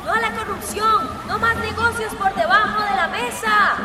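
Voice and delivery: rallying cries with punched endings; protest slogans. Acted. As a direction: call out demands to a crowd of protesters. Protest leader shouting slogans. (gritando consignas de protesta)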